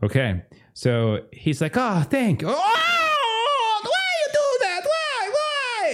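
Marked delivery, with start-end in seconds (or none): 1.8-5.9 very high falsetto wailing; comic pained scream. Acted. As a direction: shriek it in hammed-up agony. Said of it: "he's, like, screaming out in pain".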